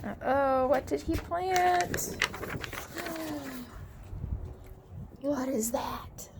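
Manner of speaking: slow hushed whisper of surprise. Acted. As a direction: whisper it like a secret discovery. Reading to child, surprise.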